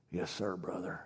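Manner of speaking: put-on very low, hushed Southern accent; deep, soft, spiritual-sounding Southern voice. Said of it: "you have to talk in a southern accent".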